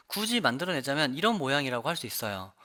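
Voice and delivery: usual speaking voice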